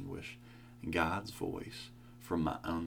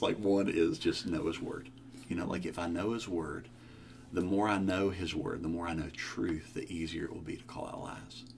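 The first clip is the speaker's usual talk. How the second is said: normal talking voice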